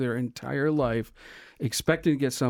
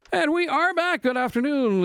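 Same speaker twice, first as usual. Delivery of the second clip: booming prize announcer; 11 semitones higher than usual. Acted. Radio host returns from break.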